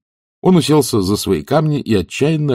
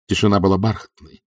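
plain reading voice

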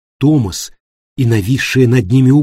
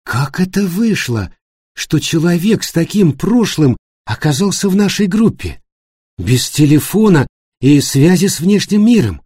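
raised pitch, insistent reproachful questioning; incredulous demand; 7 semitones higher, 4 dB louder than usual. Acted. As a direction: press for an explanation, disbelieving. Annika demands explanation from guide. (требовательно и недоумённо, повышенным тоном)

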